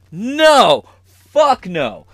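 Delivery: high, loud mock refusal; Magic Johnson refusing. Magic Johnson rejecting Fauci, loud and high. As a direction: shout the no like slamming a door. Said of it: "Magic Johnson said".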